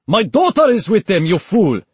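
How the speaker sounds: loud, high, enraged yell; furious shout. A narrator's reading. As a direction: scream at the fool. Sheikh furious at guard.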